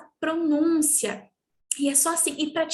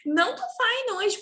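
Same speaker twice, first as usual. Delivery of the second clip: higher playful plea; mock student plea; 7 semitones higher than usual. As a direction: plead in a higher voice. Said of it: "eles falam".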